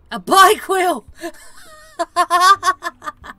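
high, loud delighted cry; excited shriek. Real, not acted. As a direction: squeal at the silly catch. Shrieking at absurd catch.

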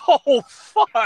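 loud, high laughing exclamation; real laughing shock; shocked amusement. Real, not acted. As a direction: burst out in real disbelief. Laughing shock at a true story.

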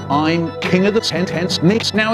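ordinary talk

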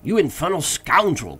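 high, loud outburst; indignant cry. A narrator's reading. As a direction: shout up a rebuke. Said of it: "cried Legrand".

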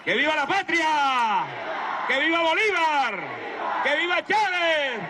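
shouted rally chants; booming balcony speech. Acted. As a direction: shout each viva to the crowd.

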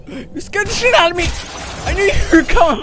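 loud, high startled exclamation; startled yell. Real, not acted. Gamer startled by a sudden enemy, shouting in fright. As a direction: blurt it out after a jump scare.

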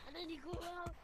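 usual speaking voice

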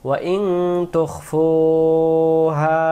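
slow melodic tajweed chanting; slow chanted Quranic recitation. Shown as a demonstration. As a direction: draw the vowels out in a sung recitation. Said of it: "টানে পড়তে হবে".

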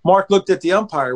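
normal talking voice

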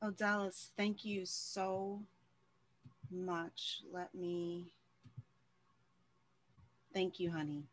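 soft, slow, choked-up thanks, close to tears; swallowing tears while thanking her. Real, not acted. Host moved by the speaker's testimony, choked-up and soft. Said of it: "You gonna have me over here boo-hooing".

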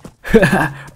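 bright burst of real laughter. Real, not acted. Player laughs while riding and killing animals. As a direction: laugh out loud at the chaos.

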